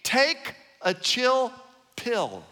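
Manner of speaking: drawn out. Acted. Slangy stretched phrase.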